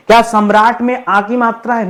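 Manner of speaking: ordinary talk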